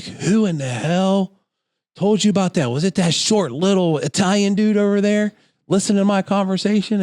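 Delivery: imitating an indignant woman, high-pitched throughout; mock outraged cussing. Woman cussing out a salesman in the lobby, mock outraged. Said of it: "starts cussing me out".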